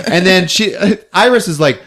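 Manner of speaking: ordinary talk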